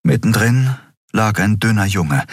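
usual speaking voice

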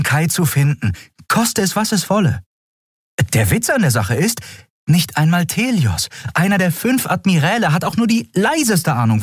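high-pitched, insistent character voice; earnest heroine. Acted. Captain explaining to doubting crew why she believes Kai, high and earnest. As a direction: argue for him in a high, earnest voice. Voices Endriel arguing her case.

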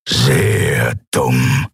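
low, menacing growl of a captor. A narrator's reading. Graufell looms over his captive before hitting her, low menace. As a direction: drop deep and threaten.

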